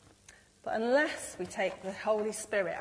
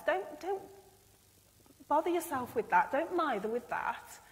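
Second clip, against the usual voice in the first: reassuring God; 6 semitones higher than usual. Retold or imitated. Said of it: "he says".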